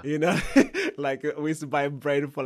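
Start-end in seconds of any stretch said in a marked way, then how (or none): 0.4-0.9 sudden high burst of laughter. Real, not acted. Laughing about Zimbabwe inflation. 1.0-2.4 speaking through laughter; laughing. Real, not acted. Laughing about inflation prices.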